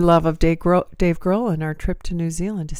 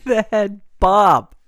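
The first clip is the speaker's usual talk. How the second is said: slow, loud, oddly stressed; Christopher Walken; 9 dB louder, slower than usual. Acted. Co-host joking about his head bob, slow Walken impression. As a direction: land hard on separated words. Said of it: "He does emphasize different parts of words".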